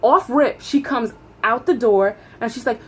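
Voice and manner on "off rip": usual speaking voice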